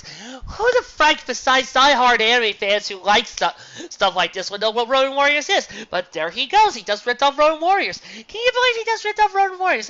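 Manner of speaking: high, loud incredulous rant; climbing into outraged disbelief. Real, not acted. Reviewer rants at the author, high loud incredulity.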